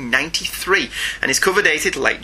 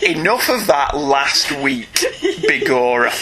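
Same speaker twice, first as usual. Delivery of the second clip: laughing through a slow refusal; drawn-out laughing protest; 8 semitones higher, slower than usual. Real, not acted. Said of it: "laughing refusal".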